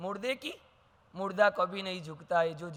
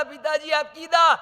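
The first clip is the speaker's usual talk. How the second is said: higher, louder call; son's urgent call; 7 semitones higher, 7 dB louder than usual. Retold or imitated. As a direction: cry out to interrupt. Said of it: "मैं बोल रहा था". (बेटे की ऊँची पुकार)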